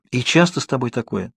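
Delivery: ordinary delivery, nothing marked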